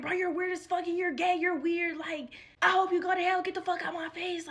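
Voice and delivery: put-on girl yelling at a classmate at the door; retold yelling. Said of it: "I'm yelling at this little girl".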